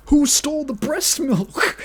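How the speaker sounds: hammed-up whodunit demand, stress on who; mock detective question.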